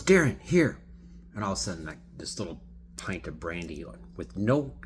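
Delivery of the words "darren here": much higher, louder handing over; animated offer. Retold or imitated. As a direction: push the bottle on him gleefully. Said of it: "very animated".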